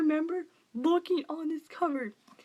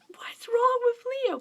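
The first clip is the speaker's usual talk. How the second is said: alarmed whisper; 11 semitones higher, 4 dB louder than usual. Retold or imitated. Reader reacting to the doctor.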